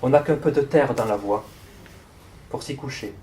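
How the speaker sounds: measured poem recital with long pauses; projected, deliberate reading of verse in short phrases; poetry reading. Acted. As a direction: read it line by line, letting each phrase hang. Reads a poem aloud.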